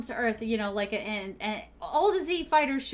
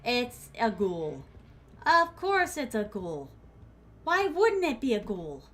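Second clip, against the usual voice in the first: normal talking voice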